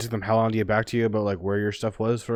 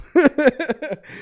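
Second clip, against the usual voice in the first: loud laugh; 7 dB louder than usual. Real, not acted. Laughing at own joke.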